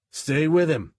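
loud urgent call; shouted. A narrator's reading. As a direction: shout it across to him. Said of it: "he shouted".

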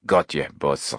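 low-pitched henchman's reply; low, obedient muscle man; brawny henchman. A narrator's reading. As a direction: answer the boss as a low-voiced heavy. Brawny henchman answers his boss.

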